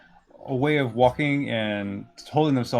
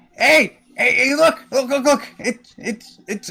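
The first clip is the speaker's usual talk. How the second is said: high, loud, stammering excitement; excited calling out; 13 semitones higher, 6 dB louder, slower than usual. Acted. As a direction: call out as if spotting long-missed heroes. Gimbal spots the returning adventurers.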